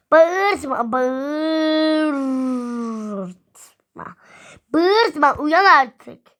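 exasperated pretend yell; play-acted yelling. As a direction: shout to rouse a sleeper, stretching the words. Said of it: "Sabah köründe bağırıyoruz".

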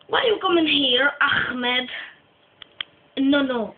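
ordinary talk, nothing marked

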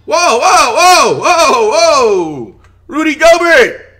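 loud, high, shocked shouts; real hype shouting at the screen; excited yelling. Real, not acted. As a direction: yell it out in disbelief. Streamer erupts at Gobert play.

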